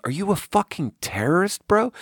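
retold challenge without shouting; held-back mock confrontation; drunken challenge. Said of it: "he looks at me and he goes".

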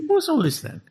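put-on high-pitched comic British accent; jokey British bobby accent, laughing. Joining the co-hosts' silly riff, high mock British accent. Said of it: "I know why they're British".

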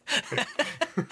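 sudden high laugh; short amused laugh. Real, not acted. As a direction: burst out laughing.

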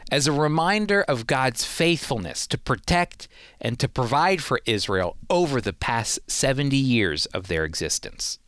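usual speaking voice

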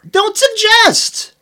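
high, slow, stretched outburst; outraged shout. Real, not acted. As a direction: yell it in mock outrage. Outraged retort.

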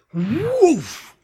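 a loud, high burst of woof; woof sound effect. Retold or imitated. Teacher mimics the mentor zooming out to the plateau, loud high woof. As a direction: blast out the woof like a sudden revelation. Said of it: "and then suddenly he's like".

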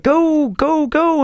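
high-pitched retold cheering-on; playful imitation of "go, go, go"; eager urging. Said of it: "And you're like".

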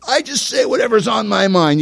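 high, mocking put-on voice of a blurter; a hint of a smug blurter; mock careless speaker. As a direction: lightly parody someone who says anything. Mocking a loose-tongued person.

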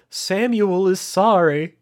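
high, slow creepy third-person voice; slow ominous raspy drawl. Retold or imitated. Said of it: "He's like".

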